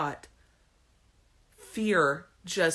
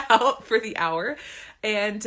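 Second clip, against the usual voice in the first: ordinary delivery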